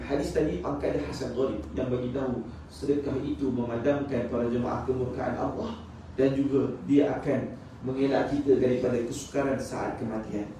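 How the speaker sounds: usual speaking voice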